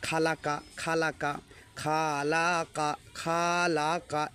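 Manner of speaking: খালাকা with drawn-out খা and কা; mock the wrong stretched vowels slowly; imitating wrong reading. Teacher imitating how everyone used to misread it. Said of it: "এইভাবে পাঠ করতো সবাই".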